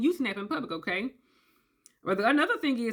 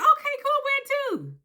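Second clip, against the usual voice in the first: cheerful; 4 dB louder than usual. Retold or imitated. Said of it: "You're like".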